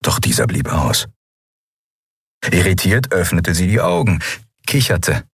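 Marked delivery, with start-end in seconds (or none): none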